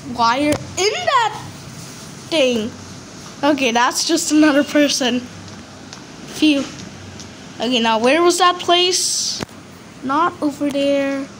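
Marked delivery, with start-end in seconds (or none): 5.2-6.6 soft breathy phew of relief; relieved sigh. Real, not acted. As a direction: let out the breath you held. Relief after hiding.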